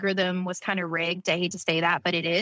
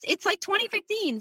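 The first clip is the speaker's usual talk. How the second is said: incredulous disbelief; 12 semitones higher than usual. Retold or imitated. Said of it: "And I was like".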